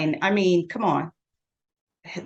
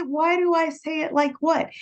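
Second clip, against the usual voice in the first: surprised question; 10 semitones higher than usual. Retold or imitated. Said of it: "And I said".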